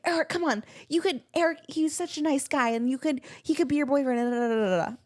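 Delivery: high pleading mom voice; imitating Mom pushing. Mom urging her daughter to date Eric, high and pleading. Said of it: "She's like".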